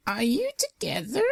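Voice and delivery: put-on high, curious old landlady; quizzing old mare. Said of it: "the old mare asked".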